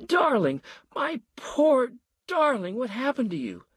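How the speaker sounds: wife wailing over her hurt husband. A narrator's reading. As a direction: wail in despair. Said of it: "knelt by her husband and wailed".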